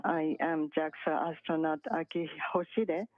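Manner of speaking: ordinary talk, nothing marked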